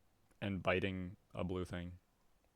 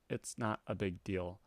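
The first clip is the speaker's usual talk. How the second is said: usual speaking voice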